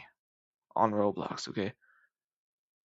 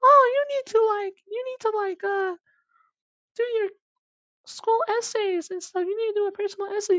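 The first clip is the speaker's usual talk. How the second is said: high, fast, squeaky mimic of the counselor; mocking counselor; 5 dB louder than usual. Mimicking the college counselor nagging about essays, high and fast. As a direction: parrot her in a silly high voice. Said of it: "she was like".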